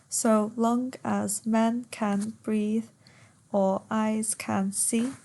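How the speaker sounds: slow, syllable-by-syllable verse reading; per suku kata. Shown as a demonstration. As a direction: count out the line, one beat per syllable. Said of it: "Ten syllables".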